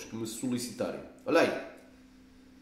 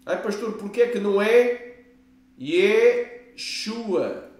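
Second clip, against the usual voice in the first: higher and louder, a mock asker's voice; questioning congregant; 4 semitones higher, 4 dB louder, slower than usual. Pastor voices a doubting believer asking him, higher and louder.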